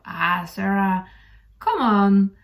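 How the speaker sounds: normal talking voice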